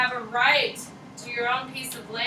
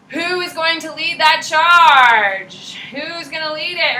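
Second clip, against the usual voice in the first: dramatic question; 4 semitones higher, 10 dB louder, slower than usual. Real, not acted. Teacher builds suspense, asks class.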